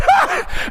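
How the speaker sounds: sudden high burst of laughter; short squealed laugh at the devil; re-enacted laugh. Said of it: "I started laughing".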